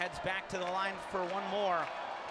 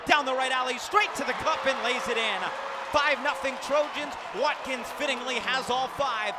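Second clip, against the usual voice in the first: rising, forceful play-by-play burst; excited basket call; 3 semitones higher, 7 dB louder than usual. Real, not acted. Announcer calls Watkins' drive to fans, excited. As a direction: punch up the drive and finish, louder.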